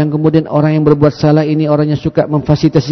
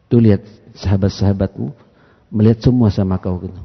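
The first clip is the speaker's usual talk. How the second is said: usual speaking voice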